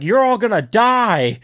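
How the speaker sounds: high, loud, drawn-out mock cry; acted-out warning shout; mock dire warning. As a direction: imitate someone crying doom to the troops. Retold dire warning to soldiers.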